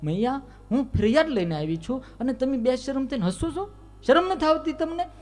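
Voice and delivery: high indignant outburst; angrier. Acted. Prabhavati scolding laughing Yashoda, high and indignant. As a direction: shame them, voice climbing very high. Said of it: "Prabhavati વધારે ખીજાણી".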